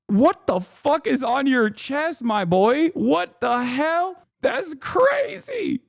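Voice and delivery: high, slow, disbelieving buddy voice; mock a shocked friend staring at the chest; high drawn-out disbelief; incredulous friend. Imagined friend calling him out.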